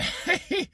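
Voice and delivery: light high chuckle; a short laugh breaking off the story. Real, not acted. Laughs at his own story.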